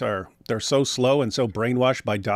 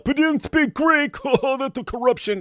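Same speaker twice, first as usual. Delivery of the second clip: much higher mock-naive voice with a laugh; sarcastic mimicry; 12 semitones higher than usual. Mocking Muslims who say Jesus spoke no Greek, high sarcastic voice. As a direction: play the gullible believer for a laugh.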